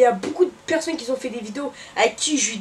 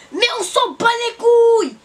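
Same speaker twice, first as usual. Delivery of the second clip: loud, higher angry outburst; heated outburst; 7 semitones higher, 5 dB louder than usual. Real, not acted. Fed up with the viewers, a loud, higher, angry outburst. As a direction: throw it out in exasperation. Heated rant about the dead man.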